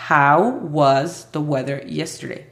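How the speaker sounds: slow, deliberate English for learners; slow model sentence. Shown as a demonstration. Teacher reading the question to Aimee, slow and careful. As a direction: spell it out slowly like a teacher. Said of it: "yo hice la pregunta con el auxiliar was".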